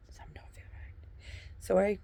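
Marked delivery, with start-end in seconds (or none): 0.1-0.9 whispered repeat; hushed aside. Shown as a demonstration. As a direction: drop to a secretive whisper. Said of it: "when I realize something don't feel right".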